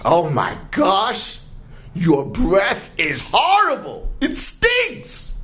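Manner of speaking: high, rising exclamation of disgust; disgusted lioness. Acted. Lioness to the lion about his stinking breath, disgusted.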